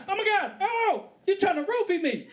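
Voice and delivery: very high panicked woman's voice; mock freak-out. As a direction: shriek the accusation as a freaked-out date. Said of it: "She freaked out".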